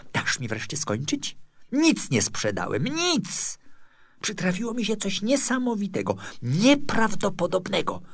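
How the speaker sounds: a touch of agitation, pitch jumping up; mildly exasperated protest. A narrator's reading. Sculptor corrects his gushing neighbour, mildly exasperated. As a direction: cut in, then insist with rising urgency.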